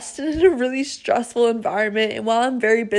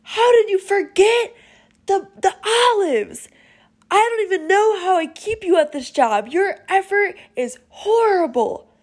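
higher-pitched mock scolding from the boss; imitating owner's yell; 8 semitones higher than usual. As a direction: replay the owner telling her off, pitched up. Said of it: "he screams".

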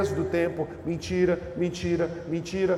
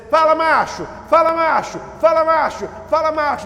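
loud, higher, hearty street greeting; buddy's greeting; 6 semitones higher, 7 dB louder than usual. Retold or imitated. Friend greeting you on the street, loud hearty call. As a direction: call out like a pal slapping your back. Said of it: "alguém que vai dizer assim".

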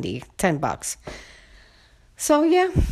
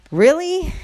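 incredulous; 11 semitones higher, 6 dB louder than usual. Real, not acted. Exasperated about neighbors.

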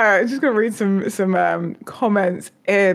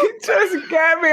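ordinary delivery

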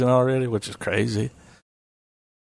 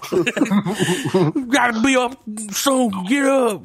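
much higher, slow, laughing through it; Tracy Morgan voice; 11 semitones higher, much slower than usual. Pretend. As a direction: giggle out a squeaky wake-up nag. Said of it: "I want my phone to be like".

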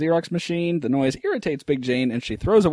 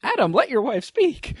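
high mock voice; 11 semitones higher than usual. Voicing a movie character.

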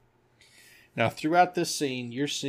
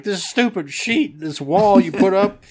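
mock angry complaint; 7 semitones higher, 8 dB louder than usual.

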